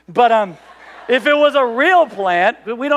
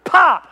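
mock pop; 6 semitones higher, 6 dB louder than usual. Chiropractor pops his ankle.

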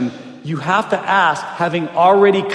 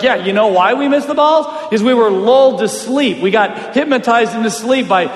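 loud, higher-pitched blame thrown back; retold heated retort; 5 semitones higher, 5 dB louder than usual. Fielders blaming their pitcher, heated retort. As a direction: hit back accusingly, building in force.

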